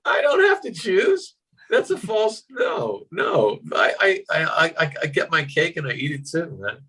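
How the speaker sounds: high-pitched playful refusal to choose; mock protest. Mock protest at forced choice.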